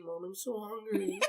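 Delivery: soft, slow, pitiful mock plea; imitated pathetic kid; starving little brother. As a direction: plead weakly like a hungry child, half laughing. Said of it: "like, starving".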